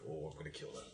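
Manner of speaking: very low, hushed aside; under his breath. Acted. Player's character privately vows to kill, under his breath. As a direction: mutter it low and soft to yourself. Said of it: "says under his breath".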